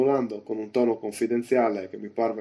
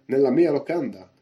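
louder, indignant commanding voice; raised voice, short of yelling; shouting innkeeper; 3 semitones higher, 6 dB louder than usual. A narrator's reading. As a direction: call out orders and a summons. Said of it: "gridò Silver".

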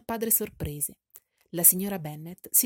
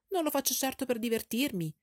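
higher, irritated retort; peevish; 6 semitones higher, 4 dB louder than usual. A narrator's reading. Kitty answering her father, higher and peeved. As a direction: snap back in a huff. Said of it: "rispose Kitty stizzita".

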